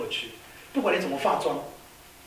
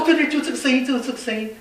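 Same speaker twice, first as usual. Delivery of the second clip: higher, louder eager cry of recognition; excited recognition; 9 semitones higher, 4 dB louder than usual. Acted. Pilot telling the mechanic he hears the noise, excited higher cry. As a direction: jump on it: that's the one!.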